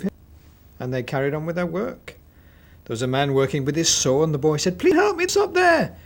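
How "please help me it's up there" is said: high, loud pleading; desperate plea. A narrator's reading. Boy begs a man with a saw, pleading. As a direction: beg for help urgently.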